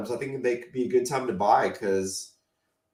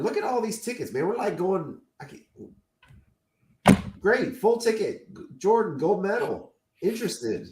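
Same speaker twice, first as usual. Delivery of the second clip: excited; 7 semitones higher than usual. Real, not acted. Excited over gold medal tickets.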